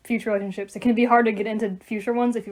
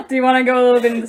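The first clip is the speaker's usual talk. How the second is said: laughing; 3 semitones higher, 10 dB louder, much faster than usual. Real, not acted. Laughing while asking friend.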